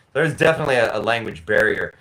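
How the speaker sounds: usual speaking voice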